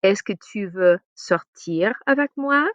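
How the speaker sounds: slow, clear invitation in French; careful French. Shown as a demonstration. Teacher models asking someone out in French, slowly. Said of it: "So the person is available".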